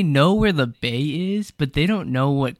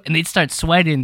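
ordinary talk

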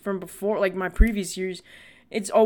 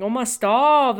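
mock protest; 4 semitones higher, 7 dB louder, slower than usual. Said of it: "I was like".

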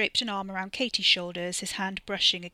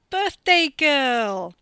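excited greeting; 7 semitones higher, 13 dB louder, much slower than usual. Acted. Greeting his sister loudly.

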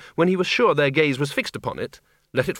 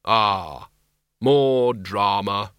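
low, slow, weary sigh of Death; deep, drawn-out reaper voice; grim reaper voice; 4 semitones lower, much slower than usual. Acted. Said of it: "Death sighed".

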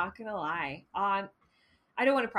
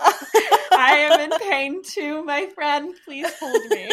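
mock crying; 4 semitones higher, 11 dB louder, much slower than usual.

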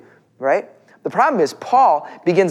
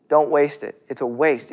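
normal talking voice

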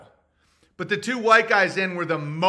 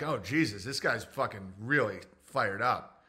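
lower, softer cop aside; muttering cop; 7 semitones lower, 7 dB quieter than usual. Pretend. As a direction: cop grumbling quietly to himself. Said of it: "They'd be like".

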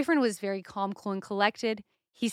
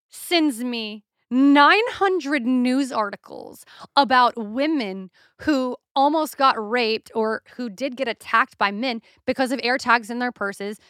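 normal talking voice

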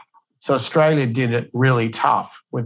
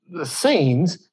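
ordinary talk

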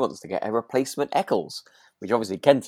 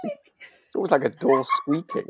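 laughing; 9 semitones higher, 3 dB louder, slower than usual. Real, not acted. Laughing at own impression.

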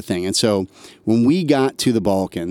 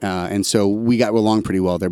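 ordinary delivery

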